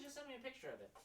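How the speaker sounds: ordinary delivery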